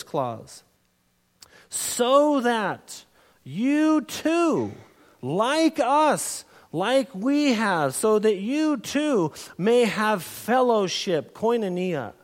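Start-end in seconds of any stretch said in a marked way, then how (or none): 1.7-11.3 very slow, higher, deliberate reading of the verse; drawn-out scripture reading. Acted. Preacher reading verse three's purpose clause to the church, slow and solemn. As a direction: stretch each word out as if it matters most.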